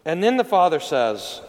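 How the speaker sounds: normal talking voice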